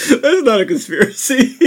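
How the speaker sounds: laughing through words; giggling as you speak. Real, not acted. Said while laughing.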